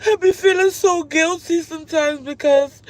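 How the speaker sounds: real tears, laughing through crying; choked guilty sob. Real, not acted. Tearful confession to her viewers.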